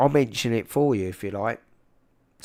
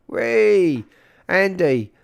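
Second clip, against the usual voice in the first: excited cheer; 8 semitones higher, 9 dB louder, much slower than usual. Real, not acted. Delighted cheer over theatre tickets.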